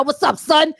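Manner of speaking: loud New York "What's up, son?"; a quick New York hello; New York accent. Shown as a demonstration. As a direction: greet like a New Yorker. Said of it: "But I do now".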